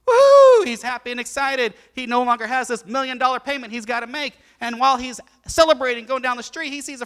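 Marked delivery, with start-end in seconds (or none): 0.1-0.6 loud high joyful whoop. Acted. Preacher voicing the forgiven man whooping with joy. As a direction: let out a celebratory yell.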